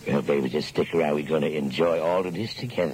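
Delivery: low, husky-free crooning DJ voice; seductive late-night DJ. Acted. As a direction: lean in low like a lounge lizard on air.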